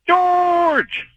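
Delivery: long, high-pitched drawn-out call; exuberant hail. Real, not acted. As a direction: holler the name out high and stretch it. Caller hails the host on air.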